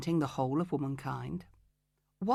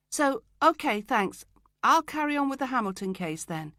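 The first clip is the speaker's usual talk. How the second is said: higher, louder, quick brush-off; hurried breezy exit; 7 semitones higher, 5 dB louder than usual. Acted. Escaping Richard's office, bright and higher. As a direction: slip out of the room with a bright higher voice.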